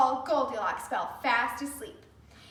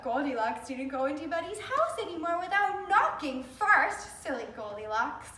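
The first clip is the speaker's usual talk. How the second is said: ordinary delivery, nothing marked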